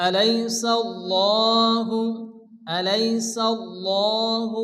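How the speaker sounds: الله with a full, heavy l; heavy lam demo. Shown as a demonstration. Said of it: "লামকে পুর অর্থাৎ ল উচ্চারণ করে".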